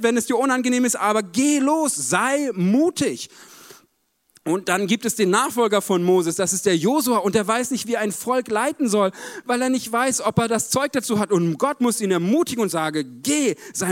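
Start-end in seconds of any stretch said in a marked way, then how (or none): none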